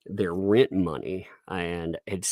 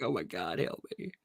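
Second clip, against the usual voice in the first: muttered plea; 13 dB quieter than usual. Retold or imitated. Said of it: "They're like".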